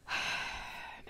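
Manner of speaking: soft, long exhale; wistful sigh. Real, not acted. Host sorry for Penny, wistful sigh. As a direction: sigh softly, a little sad.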